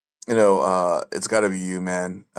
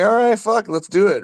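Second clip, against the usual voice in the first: very high and louder, hammed-up pumped agreement; hyped yes; 12 semitones higher, 6 dB louder than usual. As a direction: yell it up high like you're all in. Retelling his own agreement.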